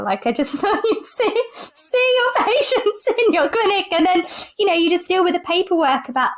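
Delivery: laughing. Real, not acted. GP recalling naive idea of clinic, laughing. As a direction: giggle through your own silly idea. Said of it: "I'm laughing 'cause it's so silly now".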